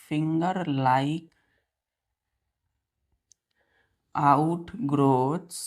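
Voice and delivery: dictating notes to the class, word by word and spelling them out; slow down so the students can copy every letter; slow dictation. Acted. Teacher dictating notes slowly.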